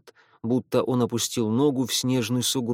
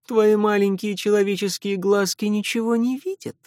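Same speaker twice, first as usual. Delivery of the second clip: high, sneering vampire voice; mocking vampire; 8 semitones higher, 3 dB louder than usual. A narrator's reading. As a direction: belittle the human child. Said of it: "усмехнулся вампир". (насмешливый вампир)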